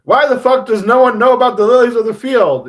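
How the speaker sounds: high, very loud mock yell; comically exaggerated outrage. Trying to make his sadness funny, hammed-up shouting. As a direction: shout it over the top to fight off sadness. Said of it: "If I make it funny".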